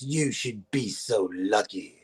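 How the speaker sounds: very low, slow, soft celebrity growl; Harvey Fierstein. Retold or imitated. Star's put-down to waiter, deep slow celebrity impression. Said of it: "you do that very well".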